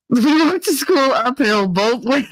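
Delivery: high-pitched, breaking into laughter; laughing while talking. Real, not acted. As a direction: crack up on your own joke.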